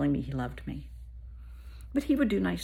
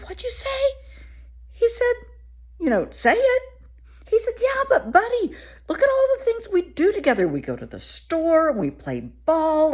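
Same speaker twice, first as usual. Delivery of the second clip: higher, louder coaxing dad; pleading dad; 12 semitones higher, 5 dB louder than usual. Retold or imitated. Imitating the dad pleading with his son. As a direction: coax the boy, buddy-style. Retelling the dad's reply.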